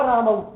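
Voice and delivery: "रामऊ" said raa-ma-u; careful "रामऊ" with a final au diphthong; au sounded. Shown as a demonstration. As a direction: glide to au at the end of "रामऊ". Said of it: "शब्द उच्चारण क्या होगा".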